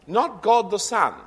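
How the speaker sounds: Not and God punched high and loud; stress on NOT. Real, not acted. Preacher warning the congregation, with a sharp stress on Not. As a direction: hit Not, then God, hard. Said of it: "note the difference here".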